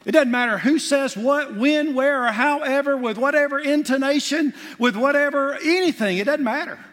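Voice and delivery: high and loud, hammering each phrase; fiery preaching. Acted. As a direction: drive the warning home at full force. Preacher builds to climax.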